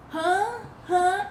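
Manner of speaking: lightly voiced clueless son; mock dopey huh. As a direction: play a distracted boy. Ten-year-old son not listening.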